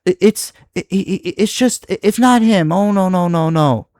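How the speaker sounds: high, dismissive sing-song of no, no, no; mocking imitation. Streamer mocking a commenter who always steps in, high mock dismissal. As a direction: mimic him brushing off your support. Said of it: "he has to step in and say".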